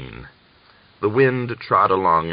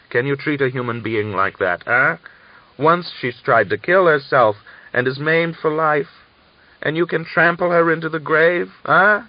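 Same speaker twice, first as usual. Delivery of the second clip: higher and louder, building to a furious shout; 9 semitones higher, 6 dB louder than usual. A narrator's reading. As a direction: rage building until it bursts on the last eh. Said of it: "Korshunov shouted".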